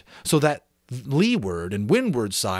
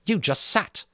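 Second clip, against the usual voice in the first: contemptuous; 9 semitones higher than usual. A narrator's reading. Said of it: "said Jack contemptuously".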